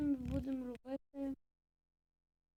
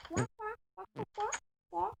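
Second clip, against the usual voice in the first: high, slow croaking imitation; playful animal noises; 8 semitones higher, slower than usual. Imitating an animal call while playing. (грайливе квакання)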